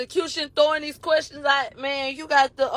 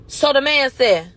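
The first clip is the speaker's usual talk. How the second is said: normal talking voice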